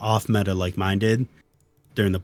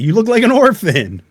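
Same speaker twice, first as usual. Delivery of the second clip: high, louder mock astonishment; imitated incredulous jeer; 11 semitones higher, 5 dB louder than usual. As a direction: blurt it as the astonished opponent. Retelling the other player's teasing.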